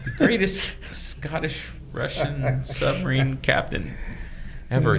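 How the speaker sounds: 3 sell it like an epic movie trailer; 4 playful trailer hype; 1 mock trailer voice.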